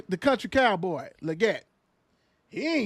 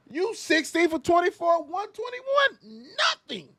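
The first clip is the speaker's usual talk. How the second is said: mocking disbelief; 11 semitones higher than usual. Real, not acted. Mocking stat line, rising voice.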